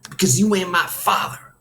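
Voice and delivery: high, hurt retort; angry teenager snapping back; snapped. A narrator's reading. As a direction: lash back at the coach. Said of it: "I snapped".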